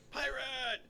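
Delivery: high, playful hail; called-out greeting. Retold or imitated. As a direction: hail a friend with a mock cheer. Voicing chat members greeting each other.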